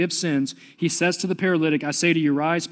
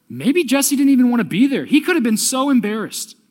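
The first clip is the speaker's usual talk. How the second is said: impassioned; 8 semitones higher, 6 dB louder than usual. Acted. Preacher imagines friend's reluctance.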